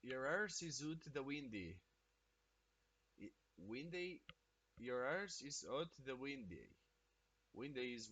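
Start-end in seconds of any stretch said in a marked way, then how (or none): 0.0-1.8 a streamer's first try at a Scottish accent, soft-spoken; Scottish accent attempt. Shown as a demonstration. Streamer trying out a Scottish phrase from chat, in a tentative Scottish accent. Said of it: "Did I nail that shit?". 4.8-6.7 another go at the Scottish accent, a touch more committed; Scottish accent retry. Shown as a demonstration. Streamer repeating the chat phrase to nail it, in a mock Scottish accent. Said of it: "Did I nail that shit?".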